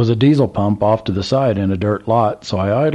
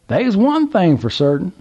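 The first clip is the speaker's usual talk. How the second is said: put-on high, excited drawl of an old country man; old Southern gentleman; 11 semitones higher, 3 dB louder than usual. A narrator's reading. Old man calls to trucker, high Southern voice. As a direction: play the curious old man at the gas pump.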